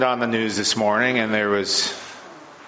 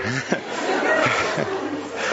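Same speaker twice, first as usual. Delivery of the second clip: breathy laugh. Real, not acted. Loses his place mid-reading.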